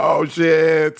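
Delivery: sudden alarmed exclamation; mock panic. DJ realising the song swears, panicked blurt.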